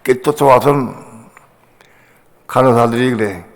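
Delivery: usual speaking voice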